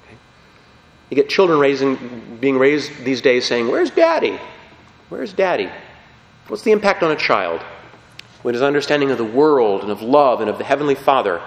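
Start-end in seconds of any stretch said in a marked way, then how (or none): 3.7-5.7 put-on high small child's voice asking "Where's Daddy?"; a child's plaintive question, pitched much higher. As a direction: play a lost little kid calling for his dad.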